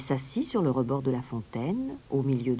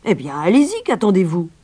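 loud, impatient grumble, pitched up; grumbling mayor; 4 semitones higher, 9 dB louder than usual. A narrator's reading. Mayor urges the stranger to start, grumbling. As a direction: grumble loudly at the dawdler. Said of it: "grogna le maire".

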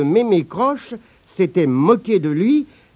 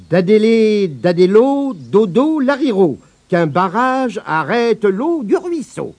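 incantation scandée et plus forte; formule magique; 4 semitones higher than usual. Acted. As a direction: psalmodier la formule comme une sorcière. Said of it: "en prononçant ces paroles". (Abigail lance son sort, incantation scandée)

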